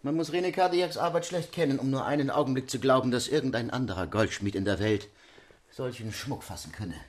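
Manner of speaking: hushed, soft voice of a goldsmith; Cardillac in character. A narrator's reading. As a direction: answer under your breath as the proud craftsman. Cardillac antwortet der Marquise. (leise Rollenstimme eines Goldschmieds)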